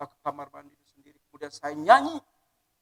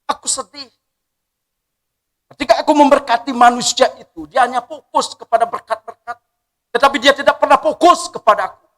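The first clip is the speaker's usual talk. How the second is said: raised, sorrowful divine lament; voice of God; 6 semitones higher, 10 dB louder than usual. Acted. God grieving over people who only seek blessings. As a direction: grieve loudly as the Lord.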